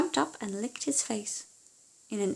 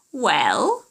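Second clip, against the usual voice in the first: witch demanding; 8 dB louder than usual. Acted.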